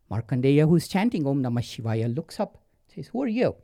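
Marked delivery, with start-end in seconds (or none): 3.1-3.6 lightly voiced boy, pitched high; innocent questioning boy. A narrator's reading. Boy looks up at Yama, high questioning voice. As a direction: ask it in a higher boy's voice.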